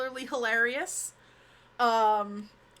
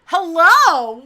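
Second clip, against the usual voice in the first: loud greeting; 6 semitones higher, 16 dB louder than usual. Real, not acted. Greeting friend on call.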